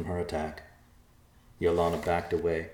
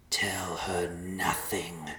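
slow ghostly whisper; 3 dB quieter, much slower than usual. Acted. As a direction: whisper it slowly, unseen. A mysterious voice.

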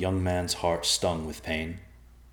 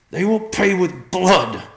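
much higher, much louder, slow furious vow; croaked in rage; 14 semitones higher, 11 dB louder, slower than usual. A narrator's reading. Son sees his father's wound and vows revenge in fury. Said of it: "Riverpaw croaked".

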